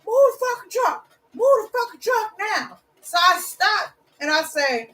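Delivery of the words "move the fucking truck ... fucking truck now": put-on much higher, strained old man yelling; mock yell. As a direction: yell it like a furious old neighbour. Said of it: "He's still yelling".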